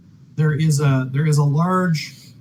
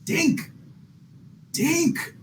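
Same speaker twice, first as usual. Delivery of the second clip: high, soft sing-song call of a name; 10 semitones higher, 6 dB quieter than usual. Acted. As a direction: call the name softly, pitched way up. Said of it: "he's kinda like".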